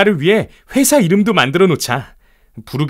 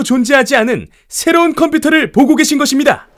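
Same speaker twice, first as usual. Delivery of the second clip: grand product unveiling; 3 semitones higher than usual. Acted. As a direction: sell it to the whole hall like a showman. Jobs unveils Apple II at expo.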